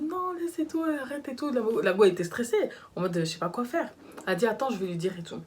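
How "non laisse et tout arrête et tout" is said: put-on high, pleading voice of the girlfriend; imitating the anxious girlfriend begging. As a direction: beg him to drop it, pitched up. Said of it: "Elle était en mode".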